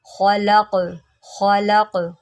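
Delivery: खलक with a thick ख and a bouncing final क; heavy kh with qalqala. Shown as a demonstration. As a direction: darken ख and echo the क at the end. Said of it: "खा को मोटा करें और काफ के ऊपर कलकला करें".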